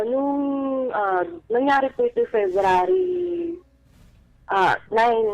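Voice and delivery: ordinary delivery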